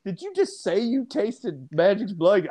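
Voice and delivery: high, slow incredulous questioning; mock disbelief. Acted listener challenging Scottie's wild claim, high disbelief. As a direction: stop him short, drawing it out high. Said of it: "You're like".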